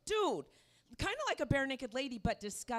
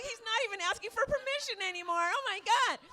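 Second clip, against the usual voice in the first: higher and fast, tumbling out in alarm; panicked rant; 10 semitones higher, 4 dB louder, faster than usual. Retold or imitated. Comic telling the audience his thoughts, fast alarmed rant. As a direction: rush it like a horrified aside.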